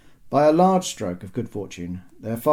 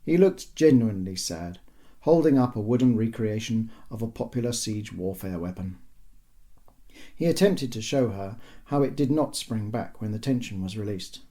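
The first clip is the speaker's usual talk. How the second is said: ordinary delivery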